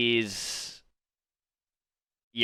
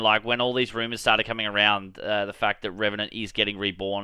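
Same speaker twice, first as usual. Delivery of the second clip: ordinary talk, nothing marked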